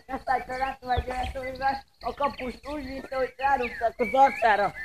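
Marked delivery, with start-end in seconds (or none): none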